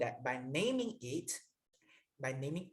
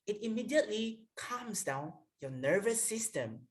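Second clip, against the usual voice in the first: normal talking voice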